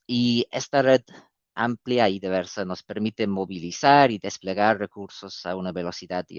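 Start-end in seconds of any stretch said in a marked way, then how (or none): none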